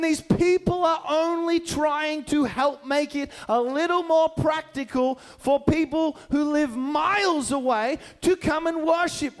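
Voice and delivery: usual speaking voice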